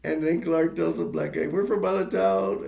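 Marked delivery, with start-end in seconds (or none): 1.5-2.6 naive out-of-towner. Retold or imitated. Dad talks to local, retold.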